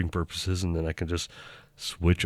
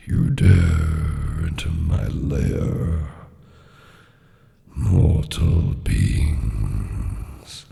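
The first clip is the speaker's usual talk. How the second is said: much lower, louder, very slow dragon; deep dragon voice; 3 semitones lower, 6 dB louder, much slower than usual. Pretend. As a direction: boom it out like a huge dragon. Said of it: "prepare with the dragon".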